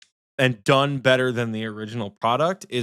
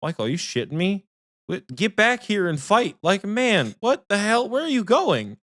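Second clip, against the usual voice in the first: incredulous taunt; 8 semitones higher than usual. Retold or imitated. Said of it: "I'm like".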